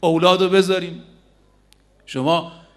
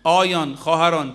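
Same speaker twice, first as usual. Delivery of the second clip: ordinary talk, nothing marked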